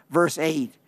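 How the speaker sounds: normal talking voice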